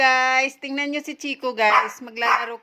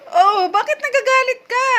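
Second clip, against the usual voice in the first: higher, louder mock-concerned question; teasing the dog; 14 semitones higher, 9 dB louder than usual. Real, not acted. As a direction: playfully scold a sulking pet.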